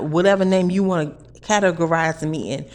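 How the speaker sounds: normal talking voice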